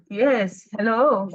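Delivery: ordinary talk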